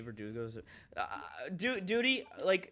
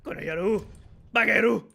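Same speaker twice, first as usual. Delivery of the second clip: loud, higher-pitched Japanese insult, hammed up; mock anime shout; 6 semitones higher, 9 dB louder than usual. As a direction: build to a big shout on the second word.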